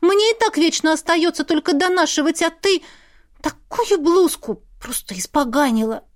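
high, aggrieved complaint; ringing with hurt. A narrator's reading. Sister accusing over a ruined blouse, hurt and indignant. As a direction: complain as if tears are near. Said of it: "Голос у неё зазвенел от обиды".

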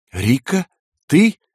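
halting question, leaping high on the last word; astonished, rising question; shocked recognition. A narrator's reading. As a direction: ask in startled disbelief.

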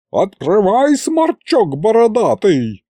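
shouting at the hiding official, "Открывай" rising; yelled insult. Acted. She pounds on the door.

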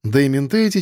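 usual speaking voice

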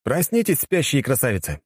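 high, teasing call to wake up. Acted. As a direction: ring out a mocking wake-up. Waking two sleepers up.

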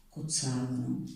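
ordinary talk, nothing marked

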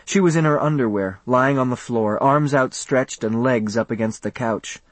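usual speaking voice

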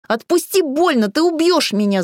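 gasping, panicked, high and loud; wind knocked out. Acted. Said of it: "задыхаясь, прохрипела Таня".

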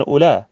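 "أولى" as ulaa, left open on long aa; without hamza. Shown as a demonstration. Teacher recalling the tribes' dialect, "أولى" without hamza. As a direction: say "أولى" with no glottal stop at the end. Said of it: "بدون همزة".

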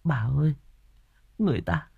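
soft, high, weakened voice; feeble murmur. A narrator's reading. Đào Nương addresses bà Hỏa after her fit, feeble. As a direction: speak faintly, with pauses between phrases. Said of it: "Đào Nương thều thào".

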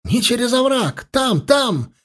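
high, frantic squeal; shriek. A narrator's reading. Elf warning them off the ravine, shriek. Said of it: "взвизгнул он".

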